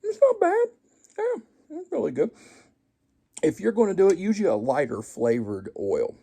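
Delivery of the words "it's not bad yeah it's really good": much higher, louder falsetto praise; high comic voice praising his own cooking; squeaky praise voice. Acted. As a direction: squeak it out like a cartoon.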